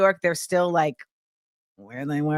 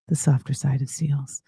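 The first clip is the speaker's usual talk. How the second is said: much lower, playful put-on voice on the show name; deep mock voice; 8 semitones lower, 4 dB quieter than usual. Host repeats her show's name in a mock low voice. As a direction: drop low and savor the name like a slogan.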